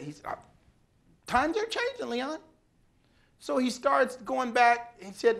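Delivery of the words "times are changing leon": mocking the CEO in a much higher, put-on voice; a high, sing-song imitation of the chairman. CEO's line retold mockingly.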